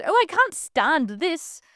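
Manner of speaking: high, drawn-out outburst of annoyance; acted exasperation. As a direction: stretch it out, voicing someone thoroughly pissed. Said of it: "she's so pissed".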